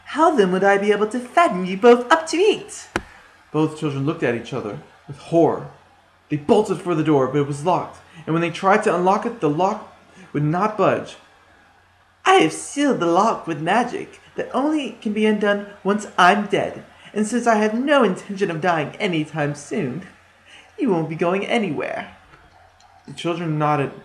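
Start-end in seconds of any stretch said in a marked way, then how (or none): 0.1-2.9 high, loud gloating witch; menacing witch, savoring it; wicked witch voice. A narrator's reading. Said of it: "gave a smile ... and answered". 12.3-22.2 higher gloating witch; taunting like a witch. A narrator's reading. Witch gloats at trapped children.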